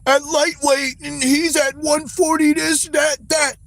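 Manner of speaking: mocking critics. Streamer parodying fans' excuses for Tank, high mock whine. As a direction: parody the excuse-makers, dragging it out. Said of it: "They be like".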